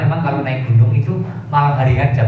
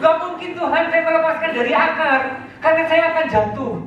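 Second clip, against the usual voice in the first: frightened refusal. Acted. Climber refuses to let go.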